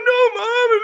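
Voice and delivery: put-on high whiny child voice, loud; crying little boy. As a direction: wail it like a small kid. Crying child answering mom.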